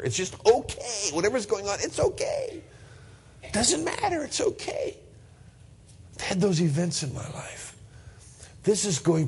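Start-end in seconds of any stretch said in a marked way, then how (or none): 0.0-5.0 high, airy voice lifting on okay; joyful reassurance. Shown as a demonstration. Pastor showing uncontainable joy to his congregation, high joyful reassurance. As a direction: let the joy push your voice way up.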